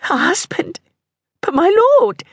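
high-pitched, startled disbelief; shocked protest. Acted. Mistress learns her lord has found her a husband, shocked. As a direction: leap up high in astonishment.

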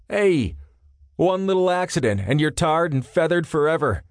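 mock-aggrieved complaint; complaining. A narrator's reading. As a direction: whine about being blamed. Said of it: "Jarrett complained".

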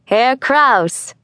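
hearty, welcoming greeting with a lift on the name. A narrator's reading. Father greets the older neighbour at the door, welcoming. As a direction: greet an old friend gladly. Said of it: "in a welcoming voice he said".

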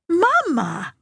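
high-pitched exclamation, falling away; scandalised daughter's outburst; shocked protest. A narrator's reading. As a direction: cry out in reproach at what mother said. Daughter protests mother's talk of dying.

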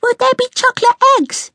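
very high, eager squeaky question; high-pitched squeal of hope; excited child. A narrator's reading. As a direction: ask like an overexcited little one. Excited young one asks about treats.

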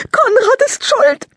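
high, loud, slow sobbing blame; crying accusation. Acted. Lila blames her little brother for the rabbit's death, sobbing. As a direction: wail out who is guilty through tears.

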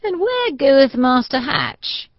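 put-on old soldier grinning up at his visitor. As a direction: tease him with a grin. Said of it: "he said, grinning".

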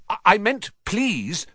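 high, flustered stammer; hurried. A narrator's reading. Nephew backtracking before his furious uncle, flustered. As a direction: blurt a correction in alarm. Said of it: "said Harry quickly".

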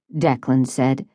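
usual speaking voice

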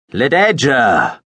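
long, slow, loud shout of her name; calling again. Acted. Veppers shouting for the hiding girl, long drawn-out shout. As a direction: stretch the name out and shout it across the stage. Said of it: "Veppers called again".

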